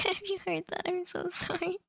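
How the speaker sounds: giggling, embarrassed sorry; laughing apology. Real, not acted. Laughs after bumping desk.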